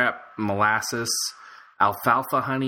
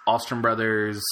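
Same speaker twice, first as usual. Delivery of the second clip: ordinary talk, nothing marked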